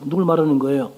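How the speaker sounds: normal talking voice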